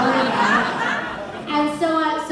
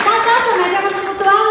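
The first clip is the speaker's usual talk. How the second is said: higher, louder, gushing shop guy; excited retold fan; 8 semitones higher, 10 dB louder, much faster than usual. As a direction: play the clerk thrilled to meet her. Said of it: "And he was like".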